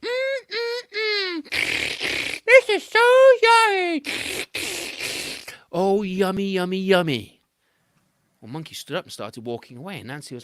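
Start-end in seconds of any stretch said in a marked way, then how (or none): 0.0-7.4 high loud yummy; mock delight. A narrator's reading. Anansi pretending to love the honey for Monkey, hammed-up. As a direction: ham up how tasty it is.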